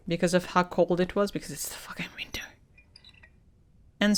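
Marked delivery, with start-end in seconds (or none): 1.3-2.4 under-breath whisper; hushed, muttered complaint; whispered aside. Real, not acted. Whispered aside about cold.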